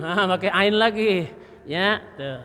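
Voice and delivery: ordinary delivery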